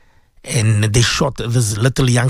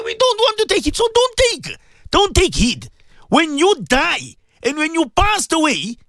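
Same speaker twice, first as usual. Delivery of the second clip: fervent preaching; 9 semitones higher than usual. Acted. Radio host preaching to his beloved listeners, fervent sermon. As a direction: preach it hard to the listeners, pleading and warning.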